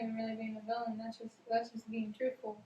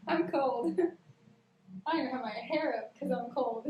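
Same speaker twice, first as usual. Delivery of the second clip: laughing through the words; 2 semitones higher, 5 dB louder than usual. Real, not acted. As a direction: giggle while you protest. Laughing about being cold.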